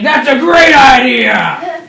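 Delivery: loud, high, drawn-out cry of approval; hammed-up shout. Pirate mate to storyteller after hearing the plan, hammed-up shout. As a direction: bellow it slowly, relishing every word.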